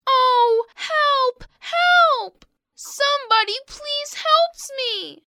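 high, drawn-out tearful plea; wailing for help. Acted. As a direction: call out pitifully from under a heap, high-pitched. Trapped piglet crying for help.

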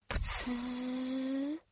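soft puffed fart noise; fart sound. Acted. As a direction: blow a little fart sound.

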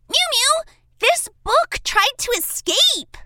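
ordinary delivery

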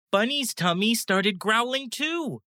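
ordinary delivery, nothing marked

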